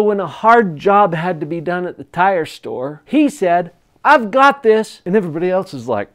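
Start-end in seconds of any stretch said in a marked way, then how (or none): 4.0-5.0 high, loud, bold volunteering; loud, self-assured announcement; eager confidence. Retold or imitated. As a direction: pipe up boldly to take the job. Young man volunteers for hard job.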